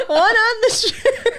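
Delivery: giggling through the words; laughing. Real, not acted. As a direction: laugh while saying it. Laughing at the teasing.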